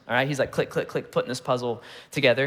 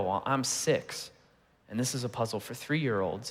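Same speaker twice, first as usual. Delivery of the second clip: understated hurt, trailing low and soft; dawning dejection; 3 semitones lower, 6 dB quieter than usual. Retold or imitated. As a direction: imitate a child's sinking realization, quietly. Voicing daughter's dawning, hurt realization.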